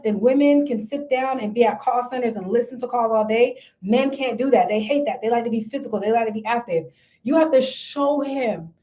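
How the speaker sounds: ordinary delivery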